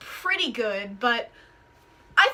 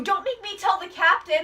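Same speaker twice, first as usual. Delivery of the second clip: usual speaking voice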